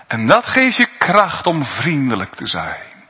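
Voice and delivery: ordinary talk, nothing marked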